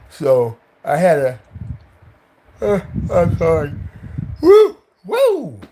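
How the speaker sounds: high loud woo shouts; whooping. Real, not acted. Reacting to the story, loud high whoops.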